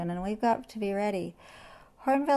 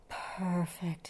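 soft, drawn-out lion purr; 8 dB quieter than usual. A narrator's reading. Lion settles on the grass bed, purred. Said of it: "he purred".

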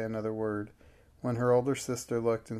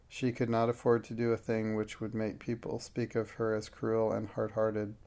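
usual speaking voice

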